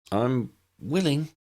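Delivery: slow, halting agreement; hesitant. A narrator's reading. Tully hesitantly agreeing to risk a charge, slow and unsure. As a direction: hold back a little before committing. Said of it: "she said hesitantly".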